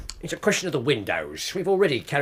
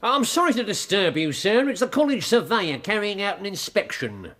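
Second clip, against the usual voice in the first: fussy surveyor; 7 semitones higher than usual. Acted. Disguised Holmes at the door.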